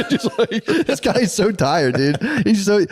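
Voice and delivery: laughing through the words, pitch jumping up; can't stop laughing while saying it. Real, not acted. Laughing about the funny guest.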